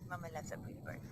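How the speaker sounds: low, soft loving murmur; tender. Real, not acted. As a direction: soothe the bird gently. Soothing the pet.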